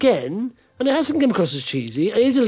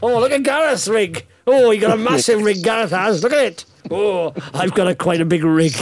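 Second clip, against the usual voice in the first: mock admirer; 2 semitones higher than usual. Teasing his podcast friends with a mock admiring voice. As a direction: gush sarcastically over the rig.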